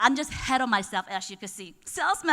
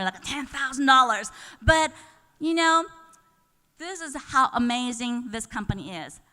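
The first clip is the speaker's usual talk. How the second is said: ordinary talk, nothing marked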